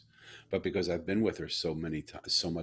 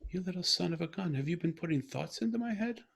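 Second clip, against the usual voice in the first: much higher, affectionate teasing; playful delight; 11 semitones higher than usual. Retold or imitated. Father teasing his daughter about reading his mind, delighted. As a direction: tease her with joyful disbelief.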